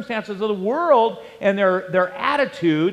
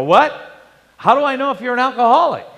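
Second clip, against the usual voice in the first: mildly incredulous retort, higher and louder; quick, raised, retold disbelief; taken aback; 6 semitones higher, 5 dB louder, faster than usual. Answering the neighbour's alcoholic question, mildly taken aback.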